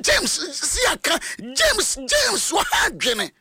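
high sing-song calls of the name James; calling out to James. Retold or imitated. Crowd calling out to James in rising sing-song. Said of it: "wɔn sɛ".

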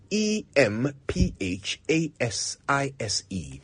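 emphasize spelt letter by letter, E M P H A S I S E; letters of emphasize spoken one by one; spelled out. Shown as a demonstration. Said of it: "notice the way I use the word emphasize".

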